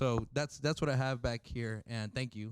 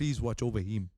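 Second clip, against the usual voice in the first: lightly put-on African accent; playful echo of an African accent; imitated African accent; 3 dB louder than usual. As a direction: copy your friend's mock African accent. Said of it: "I'm doing, like, an African voice".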